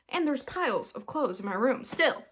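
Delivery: ordinary talk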